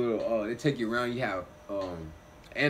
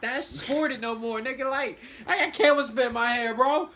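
higher, louder exasperated whine; 13 semitones higher, 8 dB louder than usual. Real, not acted. Telling friend about camel spit ruining his day. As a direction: complain in disbelief.

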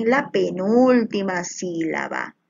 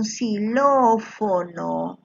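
xilófono drawn out slowly on the stressed "ló"; stress on antepenultimate syllable; much slower than usual. Shown as a demonstration. Teacher matches word to Mónica for students, antepenultimate syllable stressed and stretched. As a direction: stretch the "ló" in xilófono, then let the ending fall. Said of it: "la misma ubicación de la sílaba tónica".

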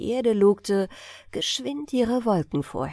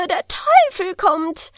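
high, slow, louder shrieking cry; 11 semitones higher, 7 dB louder than usual. A narrator's reading. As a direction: shriek it again, even louder.

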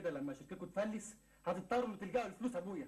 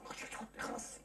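hushed urging; 4 semitones higher, 4 dB quieter than usual. Acted. Hushing partner before the girl.

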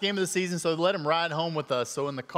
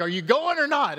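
higher, louder, pushy demand; wife demanding; 9 semitones higher, 5 dB louder than usual. Retold or imitated. Wife pushing husband in the stands, insistent demand. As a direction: press him to go right now. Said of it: "she's like".

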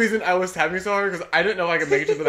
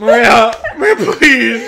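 loud, high mock plea; begging; 3 semitones higher, 10 dB louder, slower than usual. Reminding her friend how she begged, loud pleading. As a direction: plead loudly while on the edge of laughing. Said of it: "I was literally begging you".

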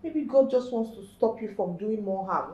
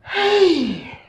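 loud high wail, falling steeply; wailing cry; 7 semitones higher, 15 dB louder than usual. Acted. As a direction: cry out in distress. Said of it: "Mommy, are you crying?".

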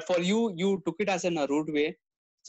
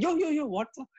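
higher, affronted mock outrage; indignant outburst; 9 semitones higher than usual. Rude guy reacting to being corrected, indignant. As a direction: play the offended guy flaring up.

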